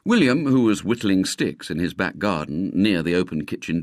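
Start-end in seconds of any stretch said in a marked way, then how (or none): none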